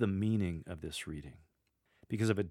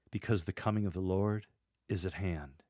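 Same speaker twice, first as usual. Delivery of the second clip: questioning rise on "Lord", a beat, then a settled fall on "hand"; question, pause, answer. Shown as a demonstration. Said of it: "making the second line of that sentence a question. Then there's a brief pause".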